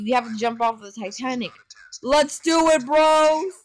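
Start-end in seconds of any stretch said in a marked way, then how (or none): 2.0-3.5 high, loud battle cry; rally the bros at full volume; rallying shout. Pretend. Player urging bros off the Titanic, rallying shout.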